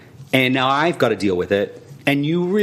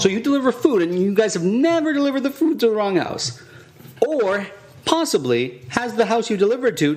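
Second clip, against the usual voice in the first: high, mocking sarcasm; mock the company's excuse; sarcastic disbelief; 7 semitones higher than usual. Real, not acted. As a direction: customer ridiculing the food company.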